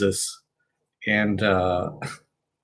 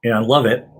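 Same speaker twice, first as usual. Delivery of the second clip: ice in mouth; 3 dB louder than usual. Real, not acted. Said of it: "I got an ice cube in my mouth".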